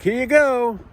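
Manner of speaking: a put-on high sing-song hand-off; high mock clerk voice. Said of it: "She's like".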